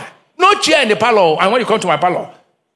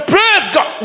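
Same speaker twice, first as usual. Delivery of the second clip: high-pitched, re-enacted praise shout; exuberant praise; 9 semitones higher than usual. Preacher recalling praising in church while hungry, exuberant. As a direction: cry out praise, pitched well up.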